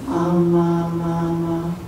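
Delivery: put-on soft sing-song "ma, ma" like a baby; baby babble. Shown as a demonstration. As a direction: coo "Ah, ma, ma, ma" like a baby. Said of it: "a baby's vocalization".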